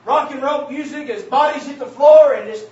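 loud, fervent sermon climax; preaching at full force. Real, not acted. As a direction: drive it home to the congregation.

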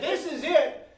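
high, loud, laughing exclamation; laughing joyful shout. Retold or imitated. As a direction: burst out with joy.

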